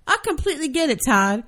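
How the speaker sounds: high, smiling, falsely agreeable; fake innocence. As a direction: agree sweetly while needling. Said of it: "smile as innocently as I possibly can".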